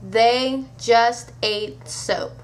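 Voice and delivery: very slow, deliberate "They. Just. Ate. Soap."; word-by-word emphasis. Acted. Punchline repeated word by word.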